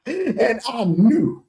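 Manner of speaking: slow, high, laughing lead-in; laugh, then draw the words out high. Real, not acted. Host gloating that he knew the rival's weakness, laughing.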